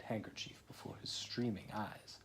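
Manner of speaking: soft whispered narration. A narrator's reading. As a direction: breathe the line out softly. Sad narration line whispered.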